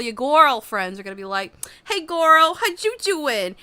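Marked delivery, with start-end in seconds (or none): 1.8-3.6 put-on high, loud gushing hey girl; sassy girlfriend. Girlfriends gushing over the viewer, high and bright. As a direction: greet her like a bubbly bestie.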